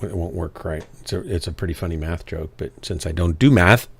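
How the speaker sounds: ordinary talk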